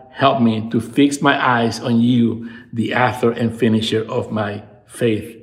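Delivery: usual speaking voice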